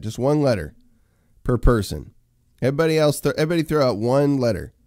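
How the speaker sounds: rally the chat to answer; calling out to chat. Real, not acted. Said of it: "start throwing letters into the chat".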